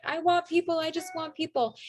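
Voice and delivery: high-pitched beginner's plea, hammed up; mock eager pleading. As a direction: plead eagerly, imitating a desperate newbie.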